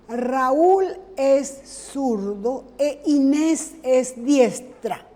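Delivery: slow, deliberate example read to the class; slow dictation. Shown as a demonstration. Teacher reading an example to students, slow and deliberate. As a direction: spell out the example sentence slowly. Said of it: "Podemos decir".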